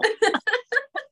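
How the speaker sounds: bright high laugh; high-pitched laugh. Real, not acted. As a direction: laugh out loud at yourself. Laughs at own skill.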